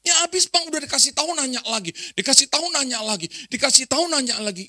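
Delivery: high, loud, fed-up complaint; acted exasperated snapping. Grown child excuses scolding a forgetful parent, acted exasperated snapping. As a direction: snap impatiently, hammering each repeat. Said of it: "mesti bentak-bentak".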